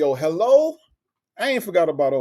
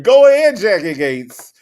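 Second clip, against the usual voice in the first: excited cheer; 4 semitones higher, 9 dB louder than usual. Real, not acted. Cheering a viewer in chat.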